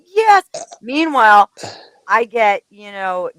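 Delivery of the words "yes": eager agent. Retold or imitated. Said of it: "It'd be like".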